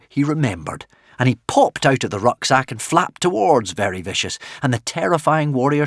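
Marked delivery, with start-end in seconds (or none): none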